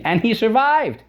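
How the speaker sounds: high, astonished punchline; amazed emphasis. Real, not acted. Climax of Marco Polo story.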